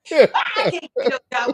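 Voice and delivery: exasperated question broken by laughter; retold frustration, laughed out loud; laughing through it. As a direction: laugh while asking why. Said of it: "I'm like".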